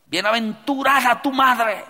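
mock emotional woman; emotional outcry. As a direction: cry it out overcome with emotion. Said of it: "cargadas de emoción".

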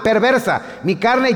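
ordinary talk

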